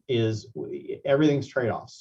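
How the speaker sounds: ordinary talk, nothing marked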